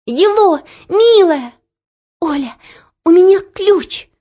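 put-on high, loud girlish gasp of news; breathless excitement. A narrator's reading. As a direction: blurt it out, out of breath. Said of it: "задыхаясь, сказала Ело".

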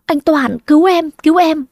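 high, frightened calling for help; crawling and begging her husband to save her; panicked cry for help. A narrator's reading. Hà crawls calling to her husband for help, frightened high cry. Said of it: "vừa bò vừa kêu".

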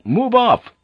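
high-pitched shouted order; commanding yell; shouting. A narrator's reading. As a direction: shout the men out of the way. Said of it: "he shouted to the men ahead".

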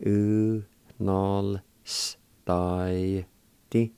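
U, nol, stai, ti in held, separate syllables. Shown as a demonstration. Teacher repeating the word for meals, syllable by syllable. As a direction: sound out U, nol, stai, ti with gaps. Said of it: "like this".

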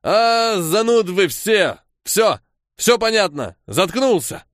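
high, indignant outburst; aggrieved boozy shouting-off; drunken resentment. A narrator's reading. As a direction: lash out like an offended drunk. Offended drunk gives up angrily.

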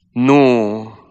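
bitter, loud refusal; bitter cry. Acted. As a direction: spit out the no with bitterness. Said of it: "strigă ea cu un ton amar".

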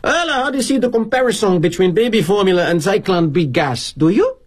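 a hint of Kroner's Havana-esque accent, needling; mildly Havana-esque, sarcastic question. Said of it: "in his Havana-esque accent".

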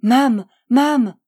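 put-on child clinging to her mother, crying out in panic; panicked cry. A narrator's reading. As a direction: scream for her mother in terror. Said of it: "affolée".

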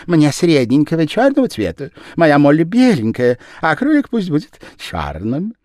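high, loud fussy lady; Madame Danton. A narrator's reading. Madame Danton ordering a rabbit, high and loud. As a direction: order it like a connoisseur.

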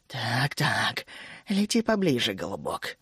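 low, soft, sinking voice; menacing coaxing. A narrator's reading. Тереза luring the dragon into range, menacing coaxing. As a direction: coax the prey quietly, dropping very low.

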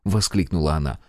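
ordinary delivery

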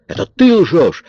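high, loud outburst; shouted accusation. A narrator's reading. Kara turns on Coridon, shouting. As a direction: throw the accusation back, pitch leaping up. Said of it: "закричала Кара".

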